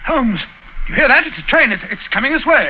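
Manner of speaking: spot the oncoming train and sound the alarm; frightened cry of warning to Holmes. Acted. Approaching train in tunnel, alarm.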